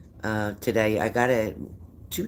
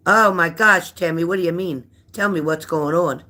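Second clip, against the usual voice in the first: loud, high oh my gosh; alarmed; 8 semitones higher, 8 dB louder than usual. Real, not acted. Startled by a viewer's bad news, loud and high. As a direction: burst out in worried alarm.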